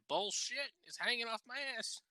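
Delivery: high, incredulous "Bullshit"; indignant outburst. Real, not acted. Ranting to a friend about toilet paper, high indignant scoff.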